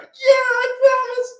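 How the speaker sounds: hammed-up grateful woman; high, slow mock woman. Woman promising Drake, high and slow.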